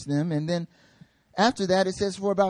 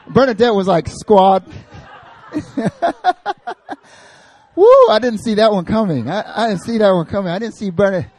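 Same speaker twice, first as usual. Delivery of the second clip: laughing; 5 semitones higher, 8 dB louder than usual. Real, not acted. Laughs at audience member.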